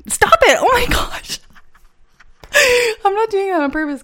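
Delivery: high-pitched, squealing through real laughter; helpless laughter. Real, not acted. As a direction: protest while cracking up, voice way up high. Cracking up at own "eh.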